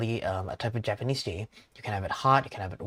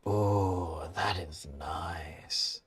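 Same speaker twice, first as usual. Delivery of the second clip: savoring customer; 4 semitones lower, much slower than usual. Acted. Old customer tasting his hojicha, hushed savoring voice. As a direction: savor the sip, hushed and drawn out.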